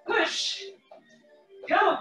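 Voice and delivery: ordinary talk, nothing marked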